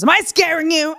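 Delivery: high, loud mock confrontation; hammed-up menacing question; angry challenge, retold. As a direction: imitate a man rounding on a bystander. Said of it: "he's getting really angry and violent".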